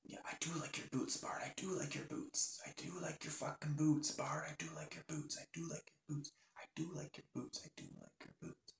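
very soft, repeated plea fading away; hushed pleading. Acted. Linda to dead Bart in the ambulance, hushed pleading. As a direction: murmur it over and over, fading to almost nothing. Sister pleads over dying brother.

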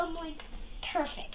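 whispering. Real, not acted. Trailing whisper after hesitation.

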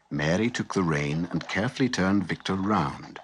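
plain reading voice

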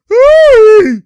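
loud, high, drawn-out whoop; excited whoop. Real, not acted. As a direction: cry out in delight at the play. Whoop after a play.

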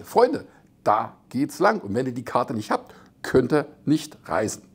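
ordinary talk

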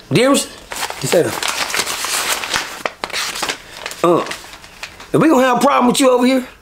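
high, fast, needling guard voice; mock guard threat. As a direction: lean in and needle him quickly. Racist guard threatens him.

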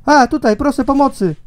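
high-pitched call for rescue to a distant boat; calling for help. Acted. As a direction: call out to the ship.

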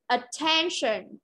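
slow "Attention", second syllable stressed; stress on TEN. Shown as a demonstration. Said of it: "rơi vào từ ten".

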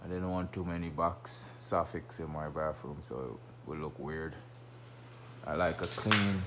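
usual speaking voice

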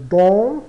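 "dans" forte e nasal; dê ênfase ao "dans" nasal. Shown as a demonstration. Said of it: "o som particular ao francês". (professora realça o "dans" bem alto)

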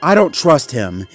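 firm distrust. Acted. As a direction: state your distrust firmly, without shouting. Zuma states his distrust.

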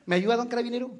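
put-on high small boy asking for help; little boy voice. Boy asking the policeman for help, high childish voice. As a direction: pitch it up like a tiny kid.

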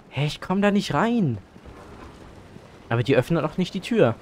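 ordinary talk, nothing marked

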